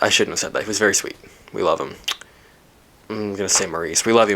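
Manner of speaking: ordinary delivery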